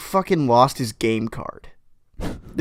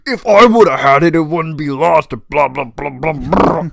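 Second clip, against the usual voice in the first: loud, hammed-up yelling dad; mock angry dad; 4 semitones higher, 8 dB louder than usual. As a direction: bark it like a furious father. Dad losing his shit.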